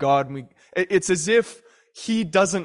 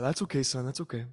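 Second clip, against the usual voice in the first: gentle reassurance; 8 dB quieter than usual. Retold or imitated. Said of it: "he's going".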